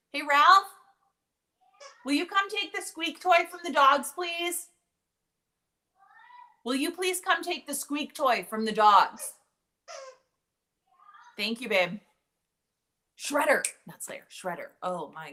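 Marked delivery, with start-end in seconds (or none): 0.1-12.0 loud, high call across the house. Real, not acted. Mom calling to her son to take the squeak toy, loud and high. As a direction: shout to be heard in the next room.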